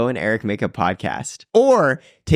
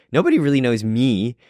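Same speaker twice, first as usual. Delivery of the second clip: ordinary talk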